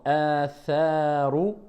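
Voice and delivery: normal talking voice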